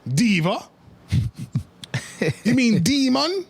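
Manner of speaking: imitating a Jamaican streamer, high; mock Jamaican patois voice; Jamaican accent. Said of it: "in a very Jamaican accent".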